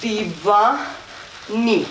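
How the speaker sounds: very slow, stretched "Divani"; slow rhythmic demonstration; drawn-out lyric cue. Shown as a demonstration. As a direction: pull each syllable out with the movement. Draws lyric out over slow move.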